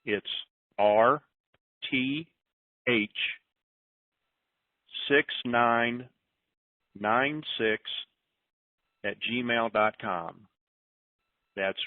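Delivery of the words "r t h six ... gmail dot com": slow, deliberate dictation; spelling it out. Shown as a demonstration. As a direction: read each character out slowly for note-takers. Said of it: "You want me to say it one more time?".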